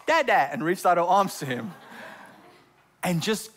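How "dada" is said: put-on light toddler voice, a touch higher and louder; imitating a toddler's happy cry; little girl calling dada. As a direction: call out like a small child reaching for her dad. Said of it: "the little girl looked at Bob and went".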